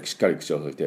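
usual speaking voice

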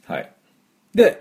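ordinary talk

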